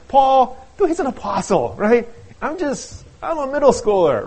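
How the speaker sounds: high, loud, indignant objection; hammed-up complaint that it's unfair; mock protest. Listener protesting comparison with Jesus and Paul, mock indignation.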